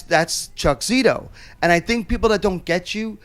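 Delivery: ordinary talk, nothing marked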